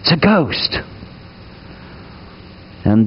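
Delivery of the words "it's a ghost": understated, drawn-out cry of alarm; hammed-up fright, held back in loudness; mock terrified cry. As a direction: retell a frightened cry without real volume. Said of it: "And they cry out".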